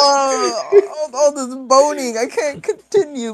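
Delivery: high mock cry, slowing to a whimper; hammed-up wail. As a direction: wail like a tortured captive, mostly for laughs. Mock victim skit, crying out.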